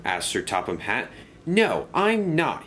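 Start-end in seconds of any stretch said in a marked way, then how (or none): 1.5-2.6 slow, higher cross voice. Acted. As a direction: drag it out, sulky and cross. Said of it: "he said crossly".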